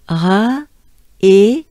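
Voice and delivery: ra then a loud e; French syllables. Shown as a demonstration. Teacher building up Trépaille piece by piece. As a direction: build ra, e slowly. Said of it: "this is a part of it".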